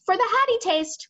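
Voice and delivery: high, loud interjection; giant crying out. Acted. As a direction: pipe up with a loud, high cry. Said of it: "cried the B F G".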